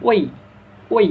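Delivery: "ওই" gliding from rounded o to released i; round then release. Shown as a demonstration. Teacher demonstrates the diphthong, rounded-then-released "ওই". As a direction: round the lips for "ওই", then let them spread. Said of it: "প্রথমে মুখটা গোল করে".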